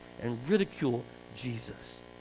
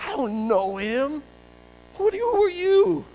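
panicky denial; 13 semitones higher, 9 dB louder than usual. Acted. Accused, denying in panic.

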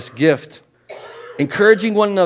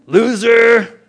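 mock scorn; 6 dB louder, much slower than usual. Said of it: "You're not like".

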